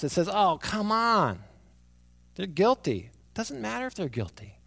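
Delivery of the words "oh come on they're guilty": mock scoff, drawn out then dismissive. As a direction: scoff like a disbelieving public. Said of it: "And the world scoffs".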